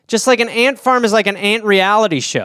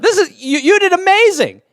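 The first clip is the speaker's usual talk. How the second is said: much higher, overeager praise; gushing judge; 11 semitones higher than usual. Acted. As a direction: gush like a pushover judge. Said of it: "I'd be like".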